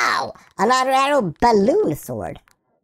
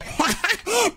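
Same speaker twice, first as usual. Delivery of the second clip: high short giggle; laugh; 9 semitones higher, 4 dB quieter than usual. Real, not acted. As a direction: giggle at your own silly joke. Amused at own poop joke.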